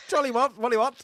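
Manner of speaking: high-pitched mock upper-class English; posh English gibberish to take the piss; really posh. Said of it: "you go really posh".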